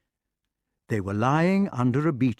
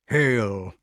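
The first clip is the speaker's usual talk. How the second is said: even, weighty greeting; measured salutation in the usual pitch; solemn wizard. Acted. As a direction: intone it gravely as a magician. Merlin's greeting, echoed by others.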